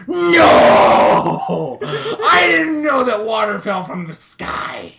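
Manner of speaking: loud, high, slow sarcasm cracking into laughter; hammed-up sarcastic shout; mock sarcastic wail.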